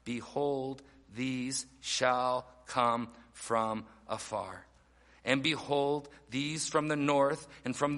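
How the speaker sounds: slow, low, measured verse reading; scripture reading. Acted. Preacher reading God's response from verse twelve, scripture reading. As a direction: spell the verse out word by word, low. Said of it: "Verse twelve".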